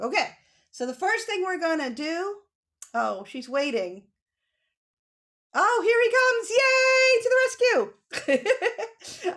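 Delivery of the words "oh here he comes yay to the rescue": high, loud happy cheer; delighted welcome. Real, not acted. Teacher greeting a late child with a high, loud cheer. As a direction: cheer him in.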